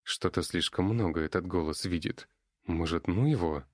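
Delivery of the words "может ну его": usual speaking voice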